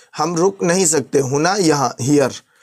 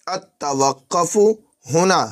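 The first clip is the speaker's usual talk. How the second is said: normal talking voice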